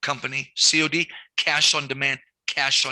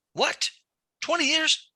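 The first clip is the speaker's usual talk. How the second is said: mock disbelief, jumping much higher; incredulous; 13 semitones higher than usual. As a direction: act out shocked disbelief. Retold argument with administrator.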